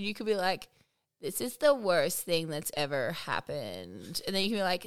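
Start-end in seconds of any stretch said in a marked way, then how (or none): 1.2-4.2 low, slow deadpan creak; emotionless vocal fry. Shown as a demonstration. Showing the co-host fry has no emotion, deadpan. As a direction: say bad news with no emotion. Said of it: "it has no emotion".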